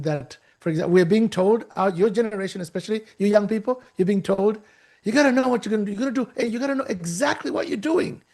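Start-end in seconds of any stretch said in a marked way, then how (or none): 5.0-8.2 higher-pitched imitation of pushy advice; insistent mock lecturing. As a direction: mimic someone laying down the rules. Voices what young people are told.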